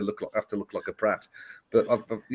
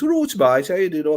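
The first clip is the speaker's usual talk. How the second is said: louder, higher Brummie greeting; Brummie accent; 6 semitones higher, 6 dB louder than usual. Shown as a demonstration. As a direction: put on a hearty Brummie accent. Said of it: "'cause it's a Brummy accent".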